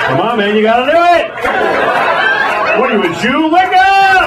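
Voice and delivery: pushy urging, climbing high at the end; goading. Acted. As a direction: egg him on, pitch rising. Acts out goading someone to comply.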